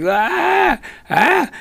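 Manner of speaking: high mock yelps of a blinded guy; pained yelp. Blinded guy yelping at the flashlight, high mock cries. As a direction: squeal like the light just hit your eyes.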